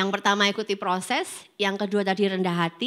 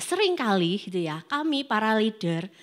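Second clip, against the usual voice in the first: normal talking voice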